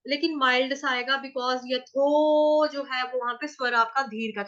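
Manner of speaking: normal talking voice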